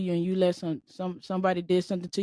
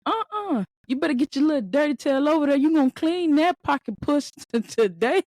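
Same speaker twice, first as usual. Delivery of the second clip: higher, fast mock scolding; pissed-off spouse; 6 semitones higher, 3 dB louder than usual. Spouse scolds the dog over bath time, mock angry. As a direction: act out an indignant telling-off, starting high. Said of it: "it had pissed my spouse off".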